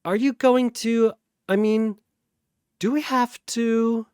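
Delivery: high, halting worry; nervous question. Acted. Sasha asking Popo if they must fight, high and nervous. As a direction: afraid of the answer.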